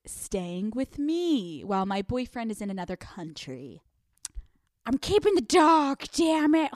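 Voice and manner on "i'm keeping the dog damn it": loud, raised-pitch mock defiance; defiant declaration. Girlfriend claiming boyfriend's dog, loud and defiant. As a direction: blurt it out defiantly.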